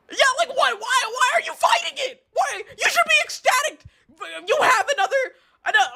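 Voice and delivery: very high, incredulous yelling; real worked-up, high-pitched protest; exasperated disbelief. Real, not acted. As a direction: plead your case in a high squawk. Arguing at Gamma in disbelief.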